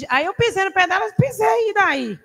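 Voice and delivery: mock proud pisei; mock defiant boast. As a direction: toss it off like it was nothing. Boasting about stepping on foot.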